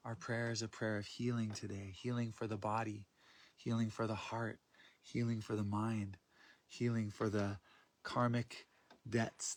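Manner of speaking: soft, hushed prayer voice; healing prayer. Acted. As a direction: pray it softly, inward and unhurried.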